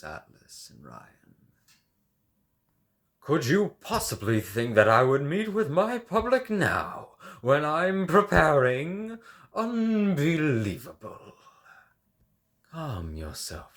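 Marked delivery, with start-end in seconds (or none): 3.2-11.3 loud, high, offended artiste; indignant outburst. Acted. Artist refusing to meet his public, loud indignant outburst. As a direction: bristle with wounded vanity.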